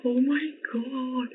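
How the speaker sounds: high, slow, breathless oh my god; high swooning awe; awed gasp. Real, not acted. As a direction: melt at the sight of yourself. Gushing over her new wig.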